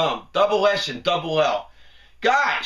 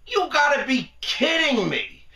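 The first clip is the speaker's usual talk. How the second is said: ordinary talk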